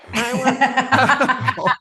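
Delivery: laughing through the words, slow and drawn out; amused, slow laugh-filled start. Real, not acted. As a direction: breaks into real laughter mid-sentence. Laughs while retelling the story.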